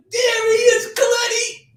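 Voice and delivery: high, loud excited yell; excited shout. Retold or imitated. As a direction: call out to her, thrilled. Kid calling cousin to fight.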